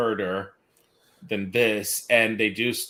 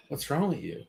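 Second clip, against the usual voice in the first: mock exasperation; 5 semitones higher, 6 dB quieter than usual. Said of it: "No, I'm just kidding".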